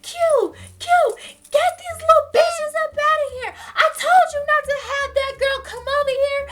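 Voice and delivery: high, loud mock scolding in a grandmother voice; yelling grandmother. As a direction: holler for help like an outraged grandmother. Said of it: "she's yelling [name]'s name".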